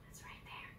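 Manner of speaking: hushed playful whisper; soft conspiratorial whisper to kids. Acted. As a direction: whisper the answer like sharing a secret. Hiding-game aside to child listeners.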